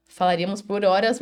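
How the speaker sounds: normal talking voice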